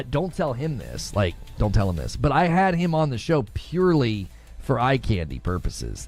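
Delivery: ordinary talk, nothing marked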